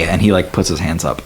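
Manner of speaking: ordinary delivery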